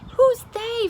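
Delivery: high, loud outburst; exasperated king. Acted. The King of Sweden demands who, high and loud. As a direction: burst out in disbelief.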